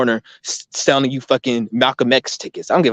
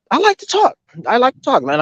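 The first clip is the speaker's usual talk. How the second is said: much higher, playful whiny insistence; defiant retort; 11 semitones higher than usual. Retold or imitated. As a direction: childishly insist you love talking. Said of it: "I'm like".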